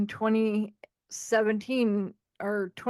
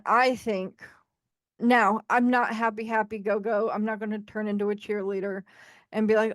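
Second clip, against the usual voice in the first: ordinary delivery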